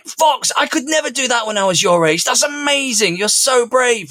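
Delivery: high, gushing praise pitched well up; genuine excitement. Retold or imitated. Dad praising son at the finish, excited. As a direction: heap praise on a brave kid. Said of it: "this genuine excitement".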